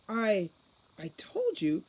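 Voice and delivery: halting, shaken stammer. A narrator's reading. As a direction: stumble over the words in shock. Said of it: "stammered Holly".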